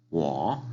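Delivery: "Wo" low, then rising; third tone. Shown as a demonstration. Teacher modelling wo for repetition, low-rising tone. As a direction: start "Wo" deep down and bend it upward. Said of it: "Es un tono de abajo hacia arriba".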